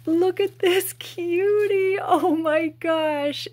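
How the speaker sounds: high, loud, drawn-out cooing; gushing delight. Real, not acted. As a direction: squeal slowly over something adorable. Gushing at first sight of toy.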